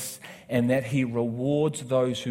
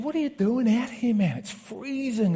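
much higher, lightly voiced concerned father; imitating the dad; 11 semitones higher than usual. Father asks the stranger why he's out in the cold, lightly voiced. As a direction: pitch up as the friendly dad.

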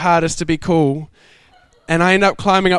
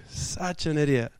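muttered self-reproach; 2 semitones lower, 11 dB quieter than usual. Real, not acted. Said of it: "self-reproach".

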